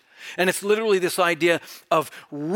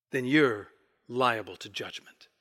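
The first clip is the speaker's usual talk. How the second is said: solemn; 6 semitones lower, 5 dB quieter than usual. Real, not acted. Solemn warning.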